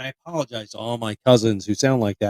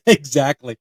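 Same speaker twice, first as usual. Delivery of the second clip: usual speaking voice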